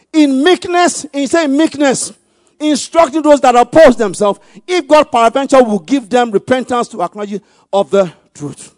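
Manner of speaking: high, very loud preaching voice; forceful scripture reading. Real, not acted. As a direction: proclaim the verse at full force. Preacher reads scripture at full force.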